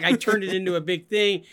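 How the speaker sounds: ordinary talk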